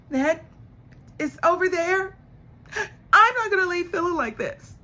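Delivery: high, loud put-on woman's whine; mock indignant protest. Recapper imitating woman protesting to him, high mock indignation. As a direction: act out her refusal in a high, hammed-up wail.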